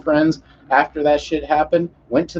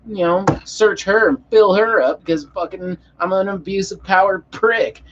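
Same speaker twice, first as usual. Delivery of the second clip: high, sarcastic parody of a cop; mocked cop; 5 semitones higher than usual. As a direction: ape the cop with scorn, voice raised in pitch. Sarcastic mock speech as the cop.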